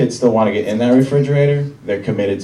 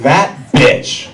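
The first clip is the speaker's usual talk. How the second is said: mock outrage, higher and louder; indignant outburst; 7 semitones higher, 5 dB louder than usual. Comic finding a strange condom at his girlfriend's, indignant. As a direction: punch it out like a betrayed boyfriend. Acts out discovering girlfriend's cheating.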